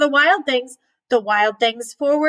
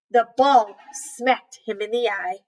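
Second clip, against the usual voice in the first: usual speaking voice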